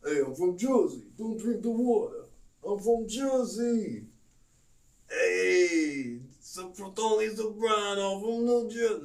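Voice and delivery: high, hammed-up Jersey bro voice; brash mock Jersey guy boasting; Jersey accent. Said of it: "Love the Jersey talk".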